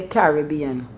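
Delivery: "Caribbean" as CARR-ih-BEE-an; Bajan pronunciation. Shown as a demonstration. Telling how Barbados says it, "Caribbean" as CARR-ih-BEE-an. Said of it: "Barbados, they say".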